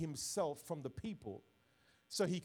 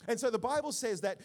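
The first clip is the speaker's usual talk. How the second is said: loud, raised preacher's delivery; forceful preaching; 3 semitones higher, 7 dB louder than usual. Real, not acted. Pastor rejecting political labels before his congregation, forceful preaching.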